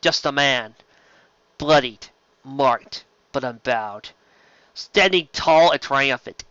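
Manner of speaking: ordinary talk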